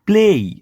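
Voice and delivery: પ્લે ending in a y glide. Shown as a demonstration. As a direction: say પ્લે as play, with a falling ei. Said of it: "પ્લે અને જોડે ય".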